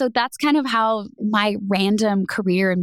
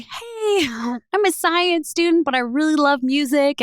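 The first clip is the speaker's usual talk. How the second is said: high, chirpy retold self-introduction; acted eager hello to a camera; mock audition pitch; 6 semitones higher than usual. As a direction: pitch yourself brightly, voice lifted high. Imitating her own audition tape pitch.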